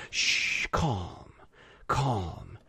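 unvoiced shh, then low, soft calm; hushing assassin. Assassin soothing the dying guy, very soft and low. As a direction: lull him down, barely audible. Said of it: "the assassin's there".

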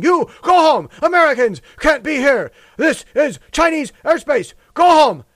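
loud, high mock yell; shouted airspace warning; yelling pilots. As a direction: bark the warning like a radio order to leave. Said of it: "coming out and yelling".

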